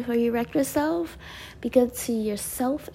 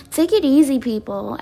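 ordinary delivery

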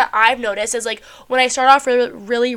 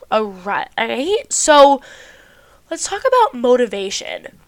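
congested, stuffy voice; talking through a blocked nose. Real, not acted. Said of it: "I sound so fucking stuffy".

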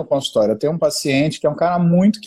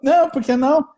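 higher, pouty wife voice; wife voiced pleading; 8 semitones higher than usual. Husband imitates his wife asking why not, higher pouty voice. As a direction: ask it like a wife who can't see why not. Wife's reply voiced in story.

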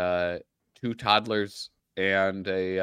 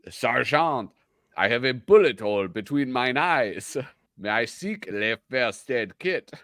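put-on French accent; 4 semitones higher, 3 dB louder than usual. Acting out comic soldier.

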